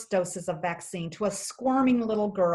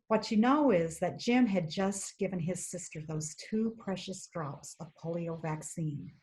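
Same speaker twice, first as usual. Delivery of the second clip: ordinary delivery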